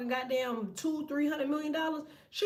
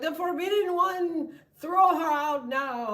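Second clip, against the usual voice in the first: slow, louder mock royal decree; 6 semitones higher, 6 dB louder, slower than usual. Guards ordering her thrown out, slow mock proclamation. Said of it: "They were like".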